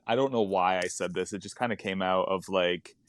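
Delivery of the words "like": usual speaking voice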